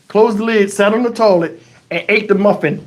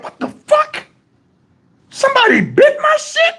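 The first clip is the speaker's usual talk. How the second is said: high-pitched squeal of disbelief; outraged disbelief. Retold or imitated. Man finding his muffin bitten, high-pitched disbelief. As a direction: squeak it in mock outrage, far above normal pitch.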